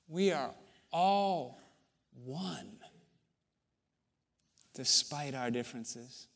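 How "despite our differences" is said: usual speaking voice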